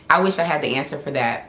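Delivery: ordinary talk, nothing marked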